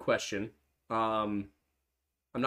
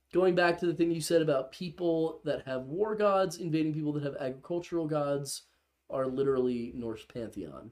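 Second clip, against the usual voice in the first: ordinary talk, nothing marked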